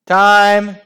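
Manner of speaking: high, very loud call; bellow. A narrator's reading. Referee ending the match, bellowing. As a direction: bellow the final whistle call. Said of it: "she bellowed".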